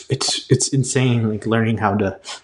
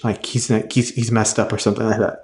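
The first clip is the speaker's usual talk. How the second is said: ordinary talk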